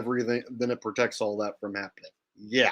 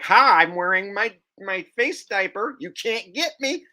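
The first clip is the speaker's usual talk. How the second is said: mock gloating; 7 semitones higher, 3 dB louder than usual. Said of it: "and you're like".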